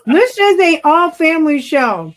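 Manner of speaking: high, loud scolding with a laugh; amused protest. Real, not acted. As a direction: object loudly, half laughing. Objects to assistant's swearing, amused.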